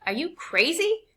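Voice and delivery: high, slow disbelieving cry; mother lunging at her husband in shock; shocked outburst. A narrator's reading. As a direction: fling it at him in outrage. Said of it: "My mother lunged at him".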